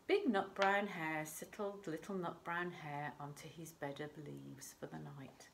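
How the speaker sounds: ordinary delivery, nothing marked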